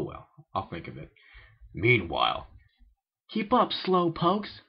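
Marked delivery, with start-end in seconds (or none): 3.3-4.6 high, slow teasing call. Acted. Rainbow Dash teasing friends behind her, high and slow. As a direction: taunt the slow ones from ahead. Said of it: "teased Rainbow Dash".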